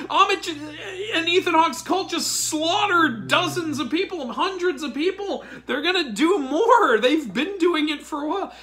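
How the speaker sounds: high-pitched exasperated laughing; ranting through laughter. Real, not acted. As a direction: keep laughing as you list the victims. Laughing rant about the cult.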